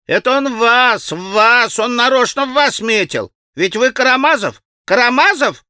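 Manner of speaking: high, excited schoolboy clamour; boys' gleeful shouting. A narrator's reading. Boys warning Karamazov he was targeted, gleeful shouting. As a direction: crow it out in a high boyish voice. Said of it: "закричали хохоча мальчишки".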